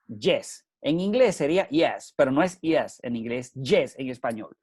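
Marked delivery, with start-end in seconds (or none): 2.2-4.6 "yes" said with a Spanish Y, like "jes". Shown as a demonstration. Speaker teaching listeners to say "yes" the Spanish way. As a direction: say "yes" with a Spanish Y sound, not English. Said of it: "pronunciando la Y".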